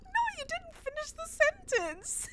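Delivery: high-pitched laughing protest; playful, squeaky rebuttal; mock exasperated correction. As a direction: argue back, amused and exasperated. Acting the exasperated reply to mom.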